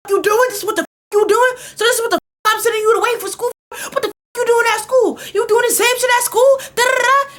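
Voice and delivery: mom yelling high and loud, fast at first; furious mother screaming at her daughter; imitating mom yelling. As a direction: shout at the drunk daughter like an angry mom. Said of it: "she started yelling at me".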